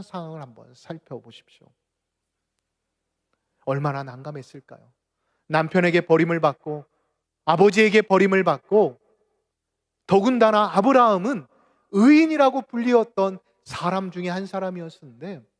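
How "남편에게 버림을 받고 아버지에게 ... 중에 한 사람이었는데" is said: loud sermon, climbing higher phrase by phrase; impassioned preaching. Acted. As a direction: build the outrage from the pulpit, louder and higher. Preaches with rising force.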